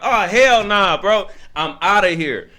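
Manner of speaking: loud, high-pitched protest; alarmed refusal. Acted. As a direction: refuse loudly, as if backing out fast. Retold alarmed refusal of husband.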